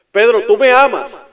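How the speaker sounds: loud, high pleading cry; urgent cry. Acted. Jesus asking Peter the third time, crying out. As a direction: cry out the question with full force.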